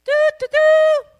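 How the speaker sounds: loud, high, slow trumpet call with the voice; trumpet imitation. Retelling the Lord's wake-up call, a loud imitated trumpet. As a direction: blare it out like a trumpet. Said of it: "I heard an audible trumpet".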